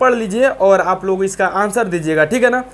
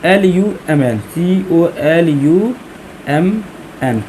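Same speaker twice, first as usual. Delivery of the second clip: spelling out; 4 semitones lower, much slower than usual. Shown as a demonstration. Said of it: "जे मतलब column".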